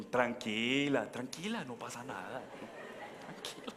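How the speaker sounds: slow calming words fading to a whisper; drawn-out, low hushed reassurance; mock reassurance. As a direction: soothe someone slowly, voice dropping away. Acting out calming a frightened passenger.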